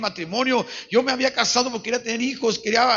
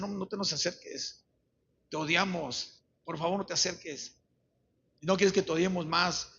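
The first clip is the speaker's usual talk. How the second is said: soft, low plea to stay away; hushed, subdued retold refusal; retold rejection; 3 semitones lower, 8 dB quieter than usual. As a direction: imitate children quietly pushing a parent away. Children rejecting their repentant father.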